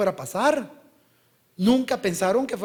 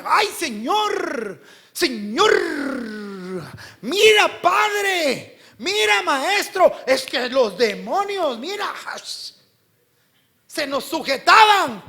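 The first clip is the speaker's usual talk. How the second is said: high, loud, drawn-out boasting to their master; excited disciples; 9 semitones higher, 7 dB louder, slower than usual. Acted. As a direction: gush with acted excitement, stretching the words. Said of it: "El Señor los ve emocionados". (discípulos emocionados, exagerando)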